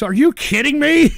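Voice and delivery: high, loud disbelief; incredulous. Real, not acted. Streamer scoffing at princess of our hearts, incredulous. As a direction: burst out as if it can't be true.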